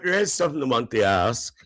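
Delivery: ordinary talk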